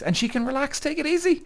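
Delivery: a high, hammed-up sappy lilt; mock sweet. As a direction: gush it up high like a sweet-talking sap. Mocking his sweet intention.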